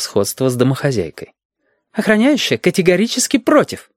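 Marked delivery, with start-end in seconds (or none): 1.9-3.9 very high, categorical woman's voice; objecting woman. A narrator's reading. Woman vetoes the plan, high and categorical. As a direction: refuse outright, pitched high.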